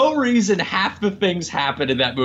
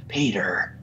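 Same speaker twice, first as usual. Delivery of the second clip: low, slow mock Jack Nicholson drawl; imitating a bad Jack Nicholson impression, low and slow; 7 semitones lower, slower than usual. Mocking the actor's villain voice to friends, low slow drawl. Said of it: "a really bad Jack Nicholson impression".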